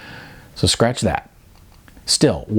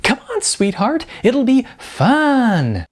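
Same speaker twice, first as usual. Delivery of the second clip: much higher, slow, sing-song cheer; super chipper coach; 13 semitones higher, slower than usual. A narrator's reading. Acting coach cheering his class, super chipper. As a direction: gush it out like an overeager coach. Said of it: "in a voice so chipper it could shatter ice cubes".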